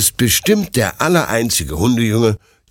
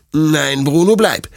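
firm, raised-pitch command; stern; 7 semitones higher, 4 dB louder than usual. A narrator's reading. Owner stops the dog following him to bed, stern. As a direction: forbid the dog firmly. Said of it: "sagt Detlef streng".

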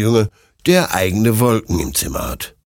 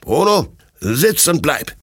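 higher, firm dog command; stern command; 7 semitones higher than usual. A narrator's reading. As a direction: order the dog down sharply. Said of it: "mit lauter und strenger Stimme".

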